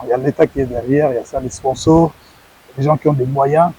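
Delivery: ordinary delivery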